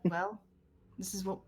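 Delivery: ordinary talk, nothing marked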